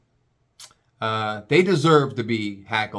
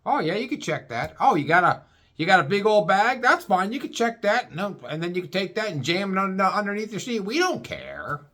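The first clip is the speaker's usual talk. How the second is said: high, breezy mock Florida agent; laid-back agent; 7 semitones higher than usual. Florida agent waving bags through, mock high easygoing. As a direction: play a carefree agent who doesn't care. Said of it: "they don't give a shit".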